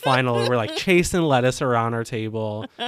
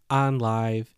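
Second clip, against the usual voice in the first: ordinary delivery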